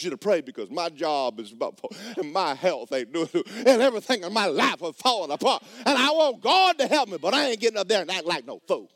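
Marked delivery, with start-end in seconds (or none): 3.7-8.9 high, loud whining plea, hammed up; mock pleading churchgoer. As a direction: play the desperate member begging for prayer. Preacher mocking a pleading churchgoer.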